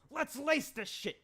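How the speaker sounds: slow, high put-on boss voice; mock tough boss. Boss rallies the boys, slow and hammed-up. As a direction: drag it out like a cartoon boss. Acted line ending the scene.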